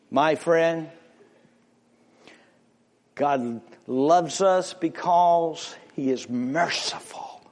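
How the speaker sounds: normal talking voice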